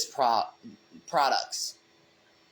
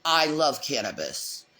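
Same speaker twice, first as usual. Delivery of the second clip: usual speaking voice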